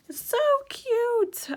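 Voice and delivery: very high, drawn-out gushing; excited squeal. Real, not acted. Vlogger showing off her second coloring book, squealing with delight. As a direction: squeal slowly over something adorable. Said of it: "my voice goes up a couple octaves".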